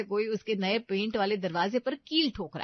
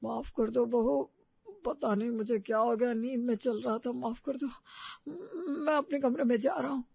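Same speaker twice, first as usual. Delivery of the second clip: sheepish apology; 3 semitones higher than usual. Acted. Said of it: "जैसे कोई छोटा बच्चा माफी मांग रहा हो".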